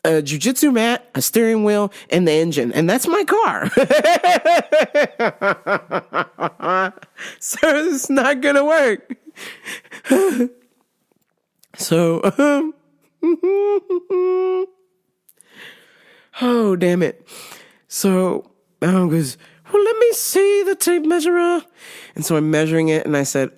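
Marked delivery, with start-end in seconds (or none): 3.7-6.9 long real belly laugh trailing down; cracking up helplessly at your own joke; laughing fit. Real, not acted. Storyteller cracks up describing her tiny car, real laughing fit. 19.7-21.6 high-pitched mock voice; put-on character voice. Retelling who wanted the tape measure, high imitation voice.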